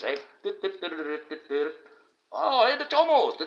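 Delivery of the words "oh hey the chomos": much higher, squeaky mock clown call. Mocking the word chomo in a high clown voice. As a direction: sing it out like a silly clown mocking them. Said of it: "I sound like a fucking clown".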